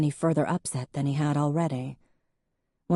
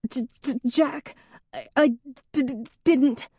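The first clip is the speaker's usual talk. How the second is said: panicked stutter, much higher, catching on each sound; 9 semitones higher, 3 dB louder than usual. Acted. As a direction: stammer helplessly as the words jam. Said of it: "The stutter opened its skeleton eyes".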